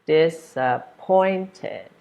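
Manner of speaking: slow dis-ap-POINT-ed, POINT lifted; disappointed syllable by syllable, stress on POINT; third-syllable stress. Shown as a demonstration. Teacher sounding out disappointed, slow, stressed on POINT. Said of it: "stress is on the third one".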